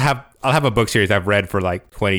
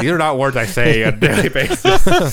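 higher, louder laughing complaint; mock protest; 5 semitones higher, 6 dB louder than usual. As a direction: protest in amused disbelief. Mock complaint over hard names.

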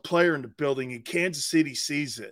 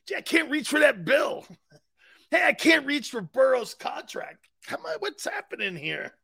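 higher, strained mock whine about short arms; mock short-armed owner; 5 semitones higher, 4 dB quieter than usual. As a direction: play a cheap owner straining to reach his wallet. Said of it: "them little short arms".

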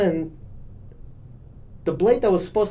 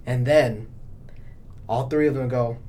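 ordinary talk, nothing marked

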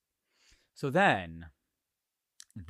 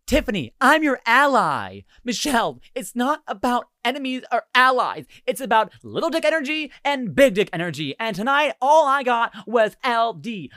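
high, loud theatrical argument; hammed-up dramatic confrontation; dramatic reading; 7 semitones higher, 6 dB louder than usual. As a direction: declaim it like two feuding drunk friends. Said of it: "verbatim a dramatic reading".

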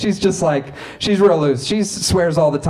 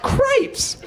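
cripes way up high, squeaked and laughing; hammed-up prudish dad at peak outrage; squeaky outraged dad. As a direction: top the first cripes with a yelp. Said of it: "My dad's like".

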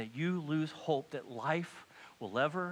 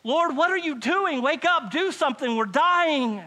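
urgent, loud high cry to the Lord; frantic pleading yell; shouting; 11 semitones higher, 9 dB louder than usual. Acted. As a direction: shout in desperation to wake him. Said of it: "They are shouting".

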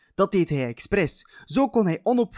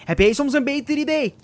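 high, loud sneering question; curt retort; 11 semitones higher, 5 dB louder than usual. Acted. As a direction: snap back at him. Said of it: "zei heel kort".

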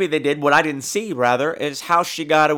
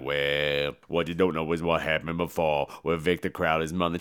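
low, soft storyteller's rumble; deep Tony Todd voice; 11 semitones lower, 9 dB quieter than usual. Retold or imitated. Tony Todd's character tells the backstory, low and soft. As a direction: drop way down and spin the tale low. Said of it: "And she's like".

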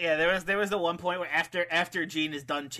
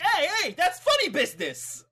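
much higher, hammed-up objection; mock protest; 12 semitones higher, 4 dB louder than usual. Money catching Ghost at funny business, mock protest. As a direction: cry foul in a high, indignant voice. Acting out indignant protest in match.

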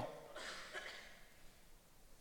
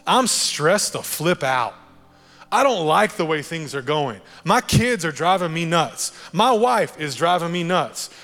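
raised, exasperated complaint, higher and louder; frustrated venting; 4 semitones higher, 6 dB louder than usual. Retold or imitated. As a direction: vent it all out, fed up and stressed. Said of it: "you're like".

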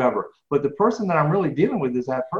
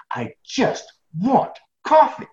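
higher, slow, drawn-out plea; mock pleading; 9 semitones higher, 3 dB louder, much slower than usual. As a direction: beg for it half-jokingly. Pleading with hotel clerk for coffee.

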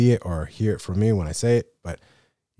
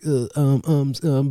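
nervous stammer; 4 semitones higher than usual. Retold or imitated. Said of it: "my voice is cracking".

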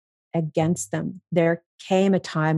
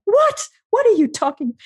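shrill, much higher, incredulous "What?"; inner outrage; 3 dB louder than usual. Retold or imitated. Voicing her unspoken reaction to the therapist's offer, incredulous squeal. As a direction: replay the silent shock loud and high. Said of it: "And inside".

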